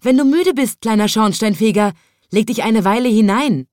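loud, high friendly call. A narrator's reading. Stork mother offers her nest, calling loudly. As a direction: call him over, bright and loud. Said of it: "rief sie".

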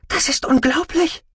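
high, amazed exclamation. Acted. Sister seeing brother wake from coma, amazed.

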